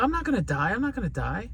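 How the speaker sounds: high, soft soothing dad; reassuring father. Retold or imitated. Father calming his crying daughter, softly reassuring.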